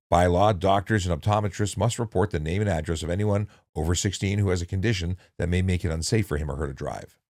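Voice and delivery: usual speaking voice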